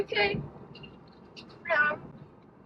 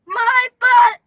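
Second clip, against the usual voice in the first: louder burst on the joke; loud punchline; 4 semitones higher, 10 dB louder than usual. Pretend. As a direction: blurt it out loudly. Punchline shouted.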